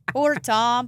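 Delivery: ordinary delivery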